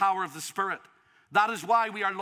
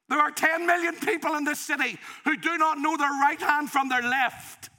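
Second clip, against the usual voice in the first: full-force preaching. Acted. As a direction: preach it hard, pleading with the church.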